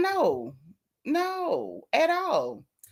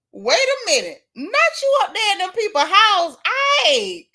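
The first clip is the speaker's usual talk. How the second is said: mock call-out; 8 semitones higher, 4 dB louder than usual. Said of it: "But I said".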